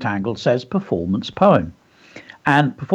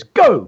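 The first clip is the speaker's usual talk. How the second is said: "Go" called out high and louder; exclamation; 9 semitones higher, 5 dB louder than usual. Shown as a demonstration. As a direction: exclaim "Go" like a command. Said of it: "put that exclamation mark in".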